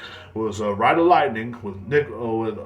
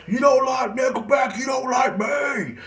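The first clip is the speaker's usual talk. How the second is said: high mock shout, slower on the end; imitated scream; 13 semitones higher, slower than usual. Friend walks up screaming, high mock shout. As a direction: shout it while storming up to him. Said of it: "he was just screaming in my face".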